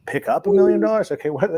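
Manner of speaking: high, loud incredulous echo; shocked disbelief. Retold or imitated. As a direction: repeat it like you can't believe the ask.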